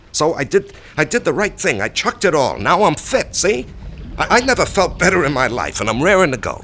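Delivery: loud, high and eager, insisting he's fine; excited. Acted. Husband to wife, insisting he's fit, excited and loud. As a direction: burst out with forced cheer to convince her.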